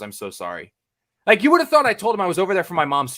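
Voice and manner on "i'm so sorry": low, hushed condolence; mock condolence. Customs officer handing back a reporter's passport, hushed mock condolence. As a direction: drop low and soft, gravely sorry.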